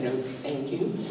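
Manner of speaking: soft, polite no thank you; quiet courteous refusal. Retold or imitated. Retelling his refusal to the mentor, softly polite. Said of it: "I was very polite".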